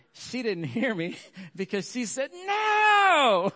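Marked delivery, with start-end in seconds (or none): 2.3-3.5 a much higher, louder retold shout of no; shouted refusal. Wife shouting back at her husband in the bathroom, loud. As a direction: yell the refusal at full force.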